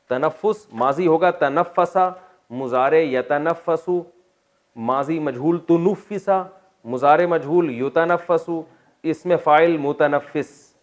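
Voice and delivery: slow, deliberate classroom chant of the verb forms; slow paradigm drill; slow recitation. Shown as a demonstration. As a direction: spell out each form like a teacher drilling a class. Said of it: "reciting verb paradigm".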